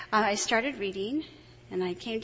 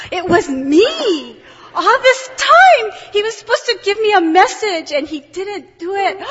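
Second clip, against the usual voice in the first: excited shout; 10 semitones higher, 18 dB louder than usual. Retold or imitated. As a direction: cry out in shock and joy. Retold shocked realization.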